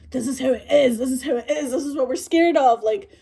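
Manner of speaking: high, loud panicky ranting; hammed-up frantic worry; mock panic. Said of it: "you have this, like, panic".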